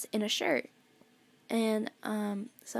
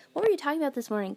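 eager question; 4 semitones higher, 7 dB louder, much faster than usual. Retold or imitated. Retold chat, teasing friend.

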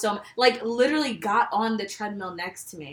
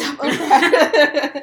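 laughing; 5 semitones higher, 10 dB louder, slower than usual. Real, not acted. Laughing taunt at friend.

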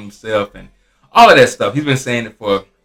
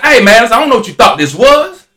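louder, higher retold challenge; heated confrontation; 10 semitones higher, 7 dB louder than usual. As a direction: square up to him over the phone. Retold phone quarrel, customer yelling.

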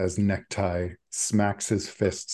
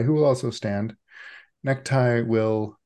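ordinary talk

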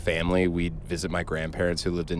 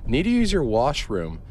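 louder repeated request; 6 semitones higher, 5 dB louder than usual. Retold or imitated. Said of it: "So she goes".

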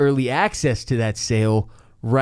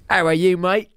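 mock Cockney accent; 6 semitones higher than usual. Host asking co-host how he is, in mock Cockney accent. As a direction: toss it off like a cheeky Cockney. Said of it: "Let's just do Cockney accents".

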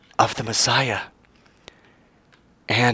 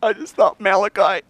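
mild tearful plea; 9 semitones higher than usual. Acted. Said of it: "as she's just bawling".

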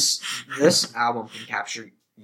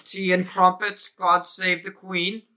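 higher-pitched hammed-up posh British, slowing at the end; English impression; 7 semitones higher than usual. Joking English impression for his cohost, hammed-up British. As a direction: play a cartoon English gent, proudly. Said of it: "English man impression".

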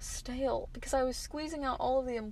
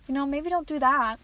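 mock scolding; 3 semitones higher, 9 dB louder than usual. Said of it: "I'd probably be like".

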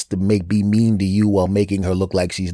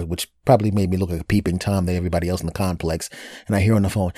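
ordinary talk

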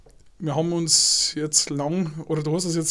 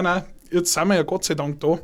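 normal talking voice

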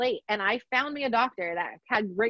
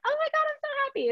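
much higher, fast, sing-song gush; mock gushing; faster than usual. Mocking people who aren't actually like this, gushing. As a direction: parody fake over-the-top happiness. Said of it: "they're not walking around like".

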